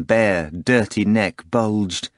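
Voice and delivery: ordinary delivery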